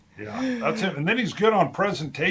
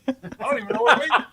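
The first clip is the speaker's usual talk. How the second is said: laughing protest; 9 semitones higher, 3 dB louder, much faster than usual. Retold or imitated. Repeats it, laughing.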